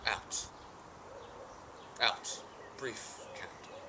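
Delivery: slow, soft and hollow soliloquy; hushed grief. Acted. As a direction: let each word die away softly. Grieving soliloquy on death.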